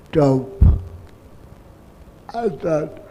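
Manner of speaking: normal talking voice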